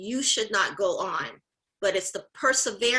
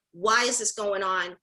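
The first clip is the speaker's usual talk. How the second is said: ordinary talk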